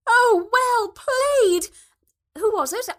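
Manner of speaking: slow, loud, high put-on rugby-mum enthusiasm; feigned enthusiasm. A narrator's reading. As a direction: overplay the delight to cover not watching. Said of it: "feigning all the enthusiasm she could muster".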